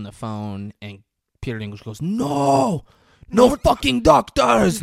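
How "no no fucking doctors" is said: high, loud, drawn-out refusal; mock furious yell. Imitating a patient refusing the doctor, mock furious yell. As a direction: bellow each word slowly in mock rage.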